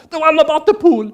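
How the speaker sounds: loud, high pointed accusation; confronting German guy. Retold or imitated. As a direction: call him out on the pool joke. German guy confronts speaker after speech.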